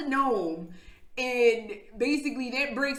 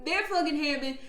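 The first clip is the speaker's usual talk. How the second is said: ordinary talk, nothing marked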